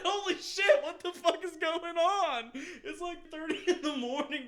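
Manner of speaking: high, loud, laughing shout; laughing hard at the drunken mess; laughing disbelief. Retold or imitated. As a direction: crack up while yelling.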